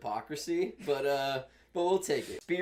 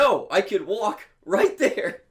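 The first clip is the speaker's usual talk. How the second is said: higher, louder, slow exasperated protest; indignant rant; 9 semitones higher, 10 dB louder, slower than usual. Retold or imitated. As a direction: protest loudly at the cashier. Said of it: "And you're like".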